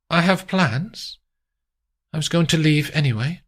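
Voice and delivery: high voice, sly; scheming lady. Acted. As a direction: savour the secret, then toss it off. Amandine scheming.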